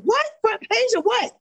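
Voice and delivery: can't believe the news; surprised. Retold or imitated. Retelling the reporter's call about the front page, stunned and squealing.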